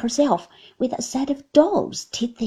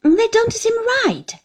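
put-on higher, louder, breathless girlish chatter; eager young wife; 7 semitones higher, 8 dB louder than usual. A narrator's reading. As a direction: tumble through the explanation, worried. Dora explains the oysters.

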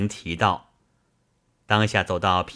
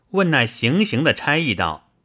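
usual speaking voice

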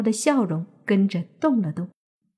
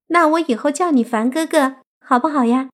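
put-on high, louder, impish girlish voice; playful fox girl; 8 semitones higher, 4 dB louder than usual. A narrator's reading. Fox girl offers to call him big brother, impishly. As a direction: coax him playfully as a little fox spirit. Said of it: "狐妖俏皮地说道".

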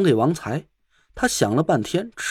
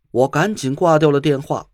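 usual speaking voice

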